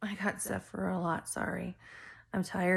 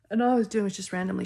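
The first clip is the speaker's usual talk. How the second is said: fast loud rush; 10 dB louder, much faster than usual. Acted.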